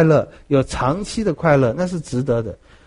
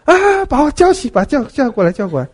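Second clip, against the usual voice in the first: crying out; 10 semitones higher, 4 dB louder than usual. Retold or imitated. King on the pyre crying out for Solon, loud and high. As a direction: call the name from the fire.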